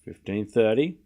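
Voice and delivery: low, quiet tennis commentary; live play-by-play. Acted. Calling the tennis rally for stream viewers, hushed commentator. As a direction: call each shot like a TV commentator.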